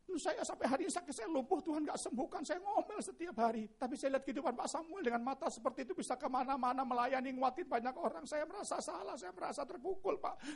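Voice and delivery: high-pitched tearful confession; imitated lament. Wheelchair user confessing to the pastor after the song, lamenting. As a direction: pour out the grievance, plaintively. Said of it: "dia meratap".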